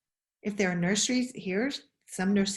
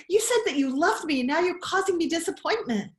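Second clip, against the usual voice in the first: mock hurt child; 7 semitones higher, 4 dB louder, faster than usual. Voicing the child to mother.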